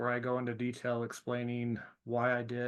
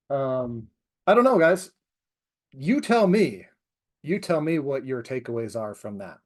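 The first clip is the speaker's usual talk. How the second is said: ordinary delivery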